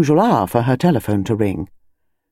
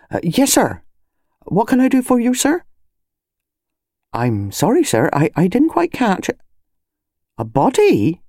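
respectful constable; 3 semitones higher than usual. Acted. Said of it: "less impatiently official".

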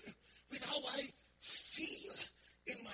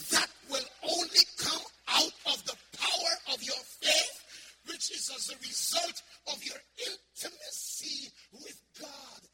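full-force preaching; 3 semitones higher, 9 dB louder than usual. Acted. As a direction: preach it out with full fire to the congregation.

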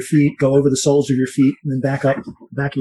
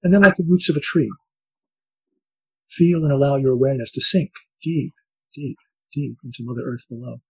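soft spa whisper; 6 dB quieter than usual. Acted. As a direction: ease them gently into calm. Leads a guided breathing meditation.

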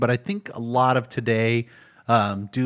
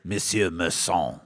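attempted French pronunciation; 5 semitones lower than usual. Shown as a demonstration. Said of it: "French pronunciation".

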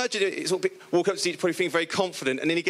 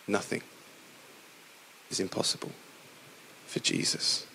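softer still, almost breathed; whispered repeat; 8 semitones lower, 9 dB quieter than usual. Real, not acted. Preacher repeating the truth to the congregation, whispered. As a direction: drop to a whisper for the echo.

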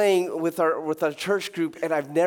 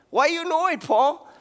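mock question; 9 semitones higher, 3 dB louder than usual.

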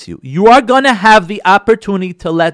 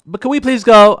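higher, louder pleading ask; pleading spouse; 6 semitones higher, 6 dB louder than usual. Acted. Wife begging her husband to visit her sister, pleading higher. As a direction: beg sweetly to go to the sister's.

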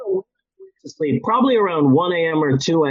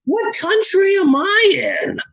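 mock panic; 12 semitones higher than usual. Retelling a panicked high trip.

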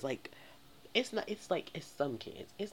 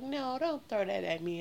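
work personality; 8 semitones higher, 3 dB louder than usual. Acted. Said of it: "I put on my best little work personality".

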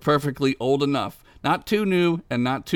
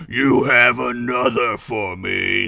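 cold rasping evil emperor; 2 semitones lower, slower than usual. Acted. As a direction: drag each word out like a dark lord. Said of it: "said the Dark Lord".

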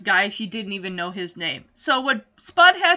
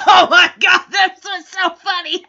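high, loud cackling through the words; laughing shout; 5 semitones higher, 8 dB louder than usual. Retold or imitated. Friend loving the prank on Punky, loud laughing shout. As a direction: shriek it out between laughs. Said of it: "she's busting a gut laughing".

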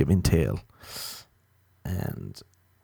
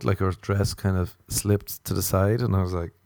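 ordinary talk

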